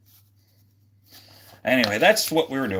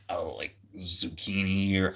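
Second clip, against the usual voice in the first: whispered aside; 4 semitones lower, 7 dB quieter than usual. Real, not acted. Joking suggestion to chat.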